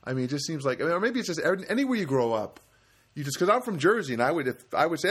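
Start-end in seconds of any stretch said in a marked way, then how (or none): none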